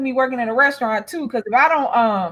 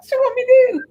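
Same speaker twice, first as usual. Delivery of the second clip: mock helpless reply; 10 semitones higher, faster than usual. Repeats her mock reply amid laughter.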